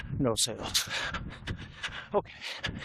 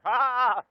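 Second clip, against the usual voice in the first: startled yelp; 8 semitones higher, 6 dB louder than usual. Real, not acted. Startled by something.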